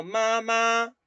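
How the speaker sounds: "mamá" with a full tone on the second syllable; the full-tone "mamá", not the neutral one; wrong version. Shown as a demonstration. Teacher showing the class the wrong way. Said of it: "Ma, no digo".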